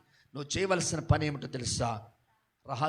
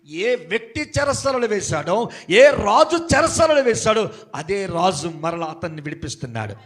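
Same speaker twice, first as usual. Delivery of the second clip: raised, driving sermon voice building up; preacher warning the church, pressing harder and louder; fervent preaching; 6 semitones higher, 17 dB louder than usual. Acted. Preaching at full force.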